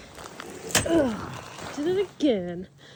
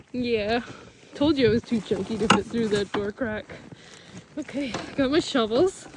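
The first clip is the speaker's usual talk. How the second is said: ordinary delivery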